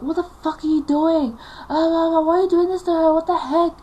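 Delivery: loud, high-pitched outrage; infuriated. Retold or imitated. Dad confronting mum over the fly stick, infuriated. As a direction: shout it in furious disbelief. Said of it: "Dad was infuriated with what Mom was doing".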